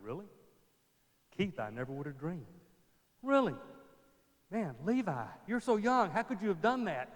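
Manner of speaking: gossiping congregation. Pretend. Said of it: "started turning around going".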